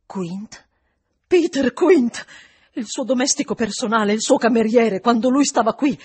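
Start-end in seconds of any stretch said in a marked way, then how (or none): 1.3-6.0 higher and much louder, rushing it out; agitated outpouring. Acted. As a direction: spill it all in alarm. Housekeeper pours out the identity.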